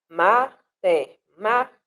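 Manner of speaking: MATEMÁTICA in five separate syllables, slowly; counting out syllables. Shown as a demonstration. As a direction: break Matemática into ma, te, má, ti, ca. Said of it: "a gente vai contar". (Matemática contada devagar, sílaba por sílaba)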